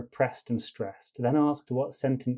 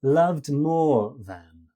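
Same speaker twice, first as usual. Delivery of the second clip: "loved more than" slowly, with long LOVED and MORE, light "than"; two heavy, one light; 4 dB louder, much slower than usual. Shown as a demonstration. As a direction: stretch and stress the first two words of "loved more than". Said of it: "palimbacus is".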